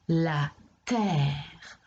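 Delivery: "La terre" said slowly, E silent; slow demo. Shown as a demonstration. Teacher modelling the word for a pupil, slow and clear. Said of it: "On dit bien la terre et non la terre".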